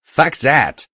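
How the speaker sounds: defiant shout. A narrator's reading. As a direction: spit out the refusal. Defiant retort.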